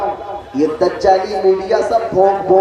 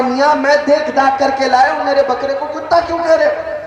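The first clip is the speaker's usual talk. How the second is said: indignant protest; 7 semitones higher than usual. Acted. Owner argues with swindler.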